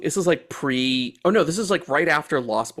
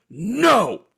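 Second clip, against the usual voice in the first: short, loud, higher "No!"; retold curt yell; 7 semitones higher, 3 dB louder than usual. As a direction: bark a flat refusal to shut him down. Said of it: "He just yells at him and shuts him down".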